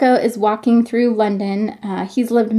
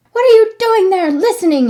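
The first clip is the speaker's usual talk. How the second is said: put-on high, loud boyish yell; angry shout; 11 semitones higher, 7 dB louder, faster than usual. Hunchback boy spotting Marco eavesdropping, loud high shout. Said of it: "he shouted".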